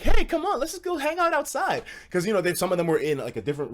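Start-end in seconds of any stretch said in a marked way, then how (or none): 0.0-1.8 imitating the girls. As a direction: lure the guys outside. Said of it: "now the girls are like".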